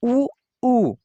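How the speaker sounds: उ said loud and high with rounded lips. Shown as a demonstration. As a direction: push उ out through rounded lips. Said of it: "इसे जब बोलेंगे". (होंठ गोल करके ज़ोर से उ)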